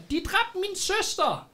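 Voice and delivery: slow, very high mock wail; crowd member's cry. Villager accuses the thieves of killing a sister, high mock shout. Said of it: "der råber".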